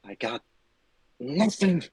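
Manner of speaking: hushed furious yelling; whisper yelling. Acted. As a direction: scream under your breath. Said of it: "your whisper screaming is not picking up on the mic".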